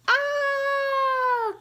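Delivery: very high, loud mock shriek; hammed-up storytime yell; dramatic cry. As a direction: shriek as the pile crashes.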